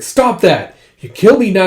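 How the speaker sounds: loud, high shout to stop; alarmed protest. Acted. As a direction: yell to halt an attacker. Character protests being attacked.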